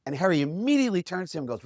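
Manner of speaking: ordinary talk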